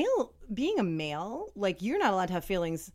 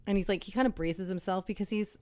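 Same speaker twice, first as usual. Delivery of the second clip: ordinary talk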